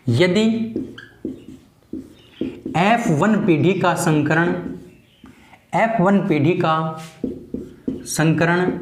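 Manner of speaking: slow, word by word, long pauses between chunks, each chunk repeated; teacher dictating notes, slow with repeats; slow dictation. Shown as a demonstration. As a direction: dictate it so students can write it down.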